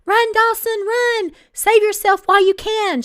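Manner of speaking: full-throated high bellow at top of lungs; hammed-up bellow of warning. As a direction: yell it across the room, all out. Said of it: "she bellowed at the top of her lungs".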